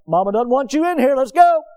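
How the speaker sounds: imitating a firm command to a pet; retold pet scolding. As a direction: build to a loud, high "Let's go".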